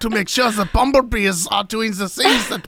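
put-on high sing-song German accent. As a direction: ham up a German scientist dad. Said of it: "this might be your best accent yet".

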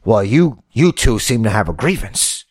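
usual speaking voice

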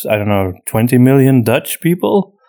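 ordinary talk

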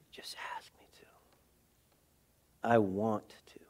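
hushed, unvoiced whisper; whispered invitation. Jesus speaking amid noise within and without, whispered. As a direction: whisper it as a quiet, intimate invitation.

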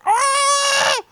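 high, sharp yelp of pain; a kid's retold scream after touching a hot stove. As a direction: yelp like the stove just bit you. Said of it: "just like".

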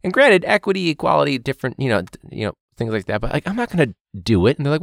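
usual speaking voice

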